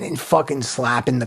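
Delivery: normal talking voice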